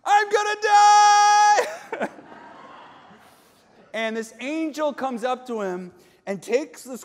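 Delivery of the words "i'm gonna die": hammed-up panicked scream, very high; mock terrified shriek, loud and drawn out; Isaiah. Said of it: "And Isaiah's like".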